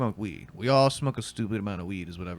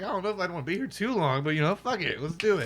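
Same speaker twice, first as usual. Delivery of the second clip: higher, shrugging go-along voice; lightly voiced hesitant self; 8 semitones higher than usual. Recalling agreeing to drink with Vegas comics, in a lightly raised voice. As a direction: give in with a shrug. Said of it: "I was like".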